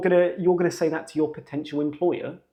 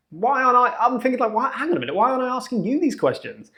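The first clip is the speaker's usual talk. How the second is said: incredulous high thought; 9 semitones higher, faster than usual. Retold or imitated. Baffled thoughts about interviewer.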